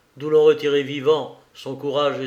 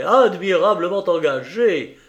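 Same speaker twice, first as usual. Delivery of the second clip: raised voice, higher and louder; exclaimed applause; 4 semitones higher, 6 dB louder than usual. Acted. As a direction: cheer the opponent on loudly. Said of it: "s'écria Bintray".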